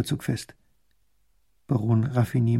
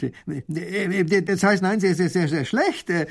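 flustered higher stammer; 5 semitones higher than usual. Acted. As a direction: trip over the words in embarrassment. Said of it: "stottert der Baron".

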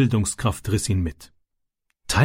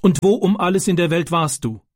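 higher-pitched, angry mother's demand; cross; 5 semitones higher than usual. A narrator's reading. Mother demands where Francis was, higher and cross. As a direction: scold the child crossly, pitch raised. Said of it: "sagte sie böse".

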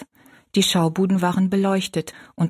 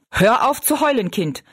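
raised-pitch scolding snap; snapping; 5 semitones higher than usual. A narrator's reading. Father tells his crying daughter to stop, snapping. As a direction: scold a crying child crossly. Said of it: "schnauzte August sie an".

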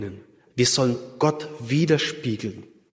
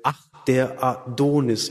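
ordinary talk

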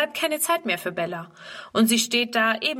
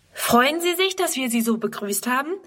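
put-on higher, bright-eyed girl's question; a girl hoping to be praised, lifted higher; eager little girl; 4 semitones higher than usual. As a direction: ask him like an excited young girl. Girl Friedrun asks eagerly.

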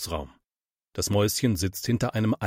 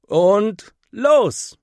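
loud, much higher cry of go; excited shout; 11 semitones higher, 7 dB louder than usual. A narrator's reading. Boy shouting to friends as the slide begins, loud and thrilled. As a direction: launch it like the start of a ride. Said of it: "ruft Henry".

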